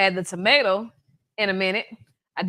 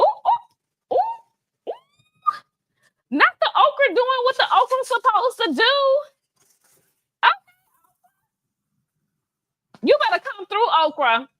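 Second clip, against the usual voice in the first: high, loud squeals over the okra; real excitement at the pot, way up high; excited; 14 semitones higher, 4 dB louder than usual. Real, not acted. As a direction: cheer the okra on, thrilled. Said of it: "I'm getting excited".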